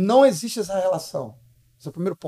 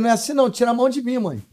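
fast, high-pitched pushback; retold protest; 3 semitones higher, faster than usual. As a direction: act out a son protesting to mom. Son argues with mother, retold.

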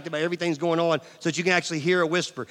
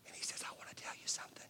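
breathy whisper with no voice at all; 5 semitones lower, 21 dB quieter than usual. Shown as a demonstration. Pastor showing how God speaks to you, whispered. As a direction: lean in and breathe the words. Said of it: "speaks to you in a whisper".

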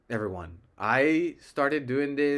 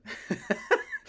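high burst of laughter. Real, not acted. Embarrassed at own accent demo.

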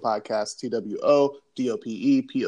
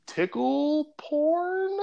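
awkward high reveal; much slower than usual. Real, not acted. Hesitant reveal of odd topic.

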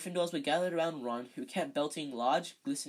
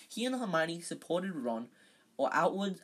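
usual speaking voice

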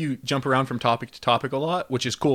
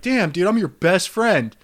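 slow, hurt; 7 semitones higher, 5 dB louder, slower than usual. Retold or imitated. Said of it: "And I was like".

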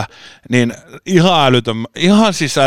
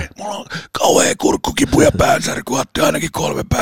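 imitated sick voice. Retelling sick voice to doctor.

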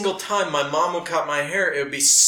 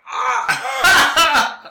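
high, loud comic yelp; mock pained scream; 11 semitones higher, 7 dB louder than usual. Recruit reacting to the hot trimmer, mock pained scream. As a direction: yelp as if burned, hammed-up. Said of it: "And I'm like".

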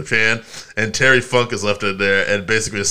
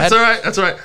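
gloating; 9 semitones higher, faster than usual. Real, not acted. Gloating repeat after winning pick.

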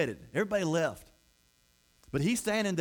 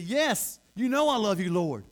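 emphatic assurance; 3 semitones higher, 4 dB louder, slower than usual. Acted. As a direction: insist on your love, raised voice. Peter answers Jesus in retold scene.

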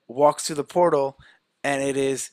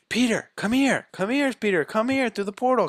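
calling out; 9 semitones higher than usual. Retold or imitated. Friends calling Peter through portal.

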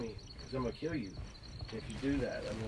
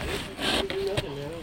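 high pleading protest; 4 dB louder than usual. Retold or imitated. Boy protesting to the angry brother, high and pleading. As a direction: pitch shoots up in protest. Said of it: "I was like".

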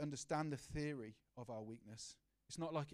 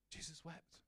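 much softer, let the words sink in; soft, hushed repeat; 2 semitones higher, 9 dB quieter than usual. Shown as a demonstration. Preacher repeating the verse to the church, softly. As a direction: breathe Jesus wept almost under the breath. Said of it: "and it says this".

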